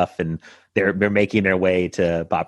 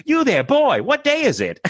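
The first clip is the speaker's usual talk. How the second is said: yelling Scrooge. Retold or imitated. She yells at her friend from the window, high mock Scrooge. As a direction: bellow out the window theatrically. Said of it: "just be like".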